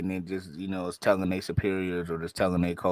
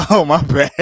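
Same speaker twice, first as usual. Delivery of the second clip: loud laughing apology; 8 semitones higher, 11 dB louder than usual. Real, not acted. Laughs after noticing frozen co-host.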